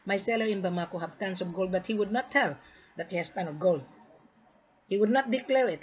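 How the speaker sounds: usual speaking voice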